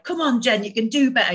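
a touch of a drill sergeant pushing herself; inner drill sergeant. Retold or imitated. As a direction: bark at yourself like a drill sergeant, lightly. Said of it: "like a drill sergeant in my internal world".

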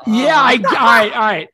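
loud delighted yelling; excited laughing. Real, not acted. GM reacts loudly, laughing.

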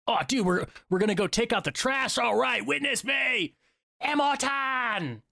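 rising to a high, strained battle cry; stoked war boy. Acted. Hyping up taking out the trash like a war boy, building to a battle cry. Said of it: "I want that energy in my life".

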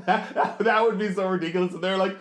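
ordinary talk

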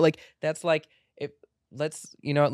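ordinary delivery

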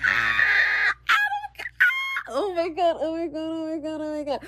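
high, thrilled fangirl squeals fading to breathless; excited screaming. Real, not acted. Fan learns Adam Conover liked her tweet, squealing. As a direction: squeal his name in disbelief. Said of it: "I scrumped it again".

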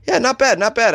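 lightly voiced impressed guest; mock satisfied guest. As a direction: play a guest shrugging it off. Mock guest reviewing the stay.

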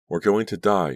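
high, loud terrified scream; terrified scream of doom. A narrator's reading. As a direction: scream it out in panic. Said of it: "she screamed aloud".

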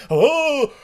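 very high, loud moans building to a cry; exaggerated orgasmic yelling; Blackula in mock climax. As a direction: wail it in hammed-up ecstasy. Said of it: "look like they're both about to come".

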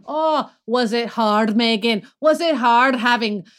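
high mocking Scottish voice; sarcastic Scottish. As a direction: sneer it with fake pity. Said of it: "He's like".

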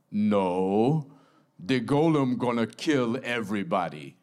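lightly voiced blunt Golem, matter-of-fact; a hint of a stolid monster stating his plan. Golem answers his rescuers.

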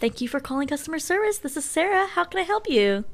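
high, sweet phone greeting, a bit fast; customer service voice. Shown as a demonstration. Answering a customer's call, in a high cheery service voice. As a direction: chirp it like a cheery call-centre agent. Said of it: "You wanna hear mine?".

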